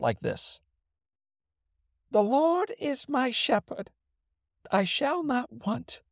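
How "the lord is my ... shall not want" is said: put-on much higher and slow, like a frail little boy; high-pitched boy's voice. Frail boy reads a psalm to a packed church, high-pitched and slow. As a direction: imitate a small child nervously reading Scripture aloud. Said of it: "in an extraordinarily high-pitched voice".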